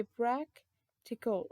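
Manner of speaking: practical drawn out slowly, syllable by syllable; slow model word. Shown as a demonstration. Teacher slowly models practical for the class. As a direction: stretch practical so they hear the syllables. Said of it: "We say".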